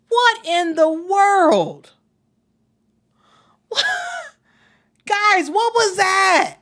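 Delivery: slow, stretched outrage at a bad pass; real exasperated shout to the viewers; heated disbelief. Real, not acted. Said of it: "I got a little bit heated".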